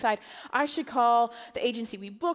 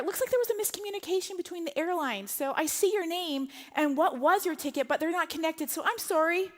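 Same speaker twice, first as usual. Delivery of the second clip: higher, lightly voiced apologetic agent; gate agent; 6 semitones higher than usual. Gate agent telling the mother she is not booked, high apologetic voice. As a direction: play the agent breaking bad news sweetly.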